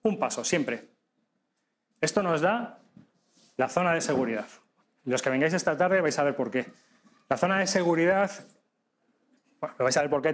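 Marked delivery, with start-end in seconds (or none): none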